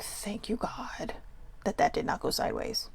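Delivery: whispered relief to God. Retold or imitated. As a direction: breathe the thanks out in a whisper. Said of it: "I was like".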